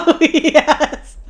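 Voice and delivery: loud, laughing oh yes; high giggling delight. Real, not acted. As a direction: laugh through the words. Laughing at the shop.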